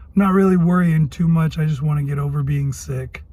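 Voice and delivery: ordinary talk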